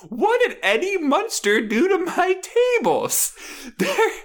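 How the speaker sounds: very high, wailing about her tables; distraught sketch woman. Retold or imitated. Retelling the sketch, very high distraught wail. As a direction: wail over ruined tables. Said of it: "she's like".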